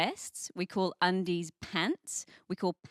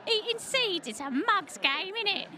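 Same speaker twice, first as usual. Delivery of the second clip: much higher cheeky mock Cockney sparrow; Cockney geezer; 10 semitones higher than usual. Sparrow on the fence chatting to a mate, Cockney accent. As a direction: chirp it like a cheeky London geezer. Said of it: "cheeky Cockney geezers".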